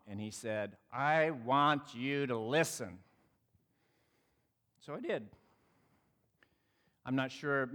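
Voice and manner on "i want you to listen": slow, measured, word by word; retold demand, drawn out for weight; deliberate demand. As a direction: spell the request out slowly. Recap of the man's demand, slowed.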